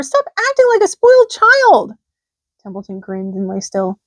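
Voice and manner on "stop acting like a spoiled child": high, loud, slow scolding shout; high loud scolding pig; angry scream. A narrator's reading. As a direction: scold him at full voice. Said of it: "screamed Wilbur".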